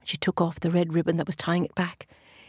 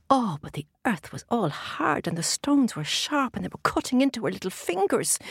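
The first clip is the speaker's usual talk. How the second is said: plain reading voice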